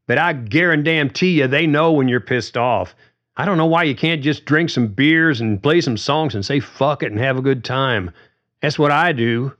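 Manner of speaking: a touch of rising irritation; riled Carly. A narrator's reading. Carly arguing back at George, mildly riled. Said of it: "Carly was getting riled now".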